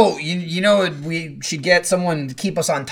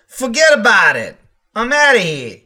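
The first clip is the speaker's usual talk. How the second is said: loud, higher mock Jersey accent; 6 semitones higher, 6 dB louder than usual. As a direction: holler it in thick Jersey. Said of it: "I had a fucking accent".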